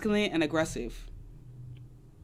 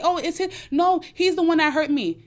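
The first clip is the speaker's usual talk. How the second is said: higher, louder imitation of complaining; put-on indignant whine, pitched up; mock blaming protest; 7 semitones higher, 4 dB louder than usual. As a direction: imitate someone protesting that they were wronged. Mimics women blaming the man.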